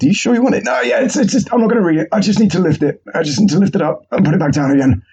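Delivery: high strained explanation; meathead voice. Retold or imitated. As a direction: keep the lifter's high grunting voice. Meathead answering librarian.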